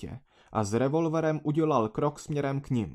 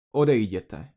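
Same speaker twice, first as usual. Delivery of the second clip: low growl, louder; 3 semitones lower, 7 dB louder than usual. A narrator's reading. Narrator growling at the villagers to leave. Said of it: "zavrčel jsem".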